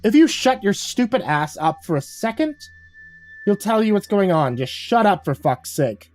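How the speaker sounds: high-pitched irritated telling-off; exasperated scolding. Acted. Woman interrupting new hire's rant, exasperated. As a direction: snap at him to be silent, settling at the end.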